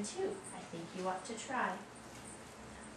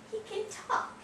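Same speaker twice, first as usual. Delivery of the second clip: high squeaky voice; 12 semitones higher than usual. Acted. Reading a sound book to child.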